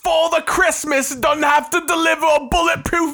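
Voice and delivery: put-on high, squawking British accent; mock British taunt. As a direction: jeer back in a hammed-up British voice. Mock British taunt about America.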